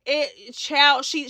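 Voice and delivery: ordinary talk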